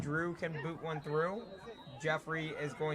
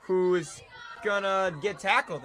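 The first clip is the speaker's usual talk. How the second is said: excited; 4 semitones higher, 7 dB louder than usual. Real, not acted. Live call of a tackle.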